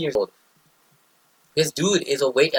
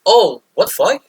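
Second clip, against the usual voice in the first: indignant outburst, higher and louder; pissed off; 6 semitones higher, 5 dB louder, slower than usual. Retold or imitated. Recruit realizing he's been used, pissed off. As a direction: let the outrage burst out. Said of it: "I'm already pissed off".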